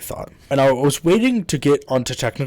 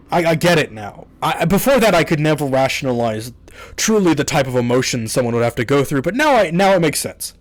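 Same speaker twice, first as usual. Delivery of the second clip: ordinary delivery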